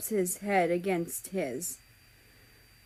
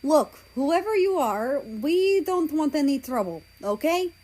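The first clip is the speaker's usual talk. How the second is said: raised voice, calling out to strangers; loud and wary; 6 semitones higher, 4 dB louder than usual. Acted. Calling out to approaching strangers, loud. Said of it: "I say loudly".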